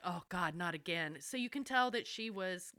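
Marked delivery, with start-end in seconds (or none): 0.0-1.2 weary eye-roll. Retold or imitated. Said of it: "rolling his eyes like".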